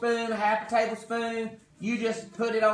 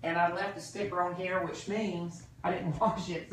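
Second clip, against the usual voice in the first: normal talking voice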